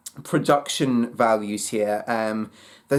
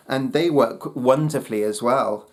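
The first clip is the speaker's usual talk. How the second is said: usual speaking voice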